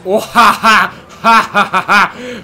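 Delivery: loud, triumphant "ha ha ha"; gloating laugh. Real, not acted. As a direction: belt out a big victorious laugh. Gloating over rival in race.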